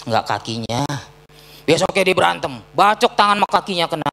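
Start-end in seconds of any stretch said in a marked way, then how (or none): 0.0-0.8 much lower, softer, slower folk wisdom; lightly voiced gossiping neighbour, low and soft; resigned bystander. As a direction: sigh it like a shrugging neighbour. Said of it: "Apa kata orang-orang?".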